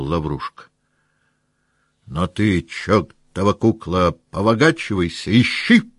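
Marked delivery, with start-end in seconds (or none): 2.0-5.9 sudden shout. Acted. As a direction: bark the order at the servant. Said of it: "вдруг закричал Денисов".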